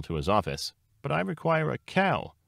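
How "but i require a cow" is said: high, tentative objection; timid bunny voice, pitched up; uncertain. A narrator's reading. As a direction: protest unsurely in a much higher voice. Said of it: "said Lily Bunny uncertainly".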